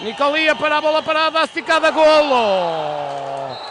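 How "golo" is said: long, loud drawn-out goal cry; explosive goal commentary. Real, not acted. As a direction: bellow the goal, held long. Benfica goal call.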